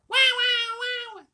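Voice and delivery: put-on very high, loud baby cry; baby wailing. A narrator's reading. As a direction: bawl like a newborn. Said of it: "Baby noise ensued".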